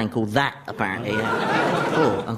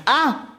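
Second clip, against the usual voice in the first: pained cry; 13 semitones higher, 4 dB louder than usual. Acted. Sudden pain.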